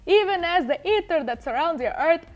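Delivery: put-on Italian accent. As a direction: declaim it grandly in an Italian accent. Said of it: "Put it in an Italian accent".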